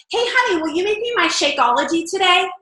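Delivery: high, sing-song sugary request with Shakeology stressed; fake salesy voice. Mocking a fake post to her husband, high sing-song sales pitch. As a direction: ham up a phony product plug. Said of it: "we don't talk like that".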